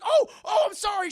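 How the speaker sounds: very high, loud startled apology; flustered yelp. Retold or imitated. Mother walking in on her son, flustered yelp.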